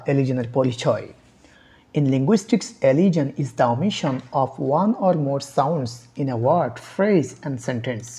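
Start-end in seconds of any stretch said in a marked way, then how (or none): 2.0-8.1 slow dictation. Shown as a demonstration. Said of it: "teacher reading definition slowly".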